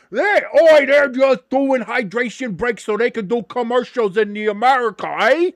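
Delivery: high, loud caricature of a complaining European; mock a European whining about America, badly; bitching European. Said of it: "the other thing Europeans are bitching about".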